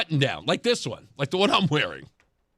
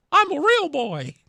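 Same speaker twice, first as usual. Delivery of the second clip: lightly voiced puppet, higher; a hint of a wooden puppet boy; 6 semitones higher than usual. Host jokes about candidate as a puppet, light puppet voice. Howdy Doody puppet comparison.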